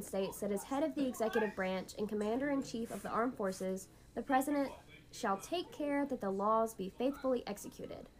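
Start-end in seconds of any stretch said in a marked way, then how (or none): none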